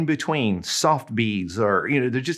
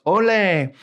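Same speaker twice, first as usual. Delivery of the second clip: crowd shout; 6 semitones higher than usual. Retold or imitated. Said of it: "everybody shouts".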